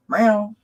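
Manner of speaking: high, loud playful meow; mock meow. Owner meowing at her cat.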